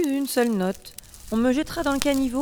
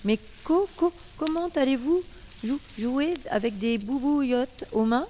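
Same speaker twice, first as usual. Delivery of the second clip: stammering on the first syllables, pitched up; stuttering; 3 semitones higher than usual. Acted. The harp, afraid of melting, stammers to the princess. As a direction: stutter nervously, stumbling on co- and jou-. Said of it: "je bégaye".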